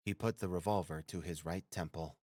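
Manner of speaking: ordinary delivery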